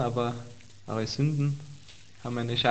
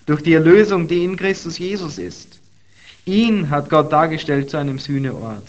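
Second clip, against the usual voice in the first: raised, energetic Bible reading; fervent scripture reading; 4 dB louder than usual. Acted. Preacher reading Romans to the congregation, fervent. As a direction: preach the verse with lifted voice.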